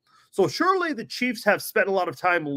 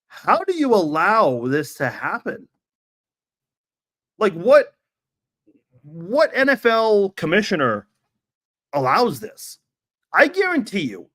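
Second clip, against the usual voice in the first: exasperated; 3 semitones higher, 4 dB louder than usual. Real, not acted. Ranting at the commissioner.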